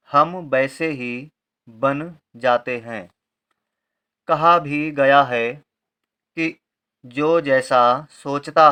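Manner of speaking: slow, deliberate word-by-word reading; dictation reading. Shown as a demonstration. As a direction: read it out slowly for pupils to write. Reads the sentences for writing. (धीरे-धीरे, शब्द-शब्द अलग करके पढ़ना)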